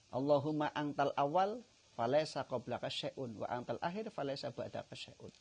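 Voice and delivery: loud devout chanting of the supplication; preaching at full force. Acted. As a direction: proclaim the prayer with fervour.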